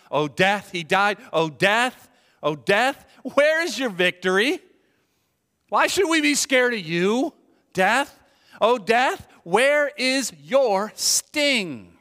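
loud, high taunt with rising calls; mocking. Apostle Paul taunting death over its lost victory, mocking. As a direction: jeer at a beaten enemy, building to a stretched ending. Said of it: "in a mocking way".

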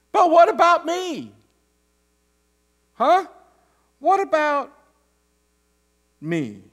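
put-on indignant older brother pleading his case; whiny what about me. Shown as a demonstration. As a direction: make it a self-pitying protest. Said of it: "boil them down to th-, just three".

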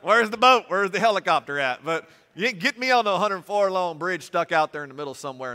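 high, loud hammed-up alarm; comic panicked yelling; mock panic. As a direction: play the scared passenger for laughs. Mock panic about long bridge.

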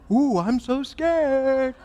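high, drawn-out sarcastic fright; mock scared. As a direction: tease with fake terror. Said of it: "he mocks them".

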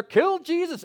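high, loud mob chant; angry crowd shouting Kill Jesus. Retold or imitated. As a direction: yell it like a mob demanding death.

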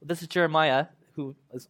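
normal talking voice

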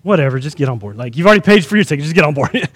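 low, soft, uninterested voice; dismissive ticket agent. Retold or imitated. Ticket agent tells Jonah to board, offhand and indifferent. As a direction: imitate a clerk waving someone through. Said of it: "person there who's looking at his ticket".

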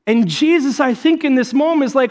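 ordinary talk

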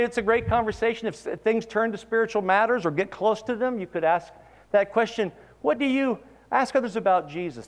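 high, loud, urgent preaching; fervent preaching. Acted. Preacher urging congregation to ask about Jesus, fervent. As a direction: lift the congregation with rising fervour.

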